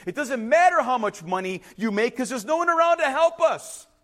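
mock desperation, rising in pitch; acted frantic. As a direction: voice climbing into a high plea. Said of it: "out of control, frantic".

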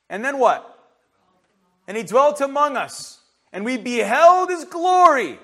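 high, loud scripture proclamation; forceful preaching. Real, not acted. Preacher proclaims the Word became flesh, forceful preaching. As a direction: proclaim each phrase, leaving room between them.

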